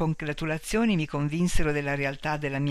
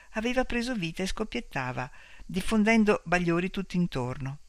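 ordinary delivery, nothing marked

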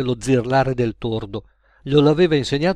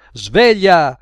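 loud, raised wake-up call; authoritative; 4 semitones higher, 8 dB louder than usual. A narrator's reading. Official waking the dozing Gaetano, loud and authoritative. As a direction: call out sharply to wake a sleeper. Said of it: "lo destò una voce autoritaria".

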